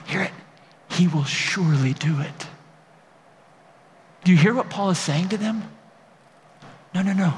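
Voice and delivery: ordinary delivery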